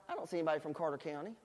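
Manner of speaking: quick, quiet grumble under the breath; muttered grumble. Retold or imitated. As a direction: mutter it fast and low to yourself. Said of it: "and I'm like".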